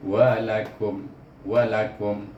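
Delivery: walakum closing lips on m; slow walakum, lips shut on m; over enunciating. Shown as a demonstration. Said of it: "terus tutup bibir".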